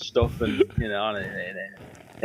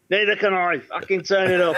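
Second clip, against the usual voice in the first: [name] voice; 5 semitones higher, 4 dB louder than usual. Retold or imitated. [name]'s punchline at soundcheck.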